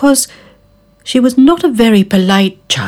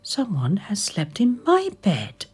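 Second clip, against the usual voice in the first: whispered mother bear; 4 semitones higher, 9 dB quieter than usual. Acted. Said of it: "growled Mother Bear".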